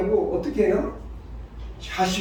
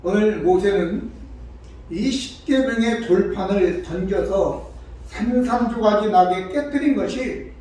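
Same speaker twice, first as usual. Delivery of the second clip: ordinary delivery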